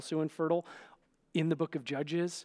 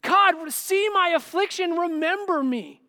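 high, shouted plea to heaven; desperate cry; 12 semitones higher, 9 dB louder, slower than usual. Acted. Hannah crying to God in prayer, desperate shouted plea. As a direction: cry out to God, pitch pushed way up. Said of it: "She cries to God in prayer".

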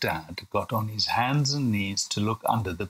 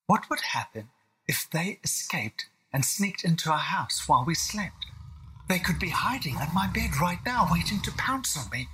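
put-on higher-pitched child voice, fretting; worried little William; 7 semitones higher than usual. William frets to himself about escaped lions, worried child voice. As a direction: voice an anxious small boy. Child figure thinking aloud in story.

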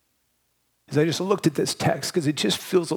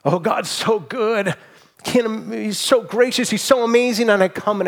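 high, emotional outpouring of praise; rising ecstatic praise, louder by the end; overwhelmed awe; 8 semitones higher, 4 dB louder than usual. Real, not acted. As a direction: voice lifted high, near tears with wonder. Preacher overcome by God's love.

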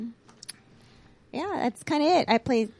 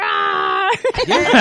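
dinosaur roar; 10 semitones higher, 8 dB louder than usual. Pretend. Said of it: "sounded like a Pixar dinosaur".

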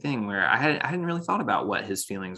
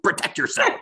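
mock scolding; 10 semitones higher than usual. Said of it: "I'm getting angry".

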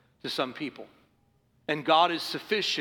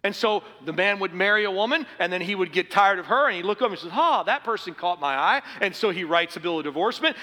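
fiery preaching; 5 semitones higher, 5 dB louder than usual. Acted. Preaching at full force.

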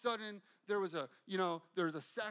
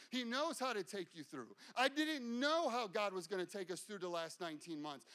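usual speaking voice

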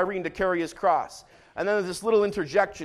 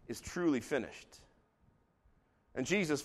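usual speaking voice

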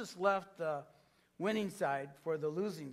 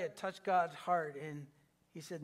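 ordinary delivery

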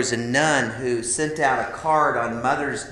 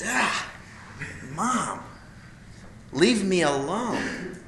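put-on higher, slow whiny teen protest; annoyed teenager; 4 semitones higher, 4 dB quieter, much slower than usual. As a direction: brush mom off, exasperated. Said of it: "I remember just like".

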